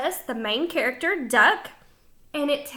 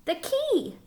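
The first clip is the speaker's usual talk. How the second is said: answer key; 10 semitones higher, 5 dB quieter than usual. Acted. Teacher answers own question.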